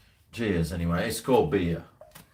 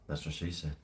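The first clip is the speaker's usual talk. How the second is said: low, soft deadpan aside; muttered punchline; 7 semitones lower, 13 dB quieter than usual. Acted. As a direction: drop it under your breath, dry. Repeated deadpan joke.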